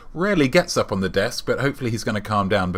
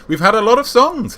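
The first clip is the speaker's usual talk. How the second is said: high, loud, climbing exclamation; 11 semitones higher, 5 dB louder than usual. Real, not acted. Host answers viewer about a tie-in album, exclaiming high and loud. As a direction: pitch soaring up to songs, then dropping.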